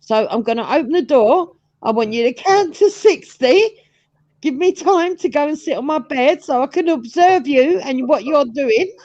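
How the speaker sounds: put-on high, fussy old woman; lightly voiced anxious old mum. Voicing seventy-year-old mum.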